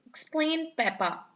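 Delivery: ordinary delivery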